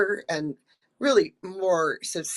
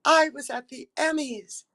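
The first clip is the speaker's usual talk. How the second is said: amazed realization; 6 semitones higher than usual. Retold or imitated. Actress finishing the playback, amazed. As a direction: marvel at it. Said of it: "I thought".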